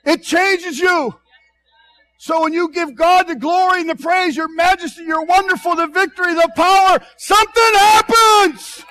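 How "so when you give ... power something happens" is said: higher and louder, climbing to a shouted peak; building preaching shout. Real, not acted. Preacher to congregation, rising to a full shout.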